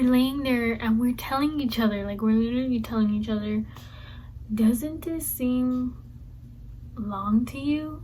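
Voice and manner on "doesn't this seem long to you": a slow, wondering question; slow drawn-out question. Retold or imitated. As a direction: stretch the words out slowly, puzzled. Friends in the restroom wondering.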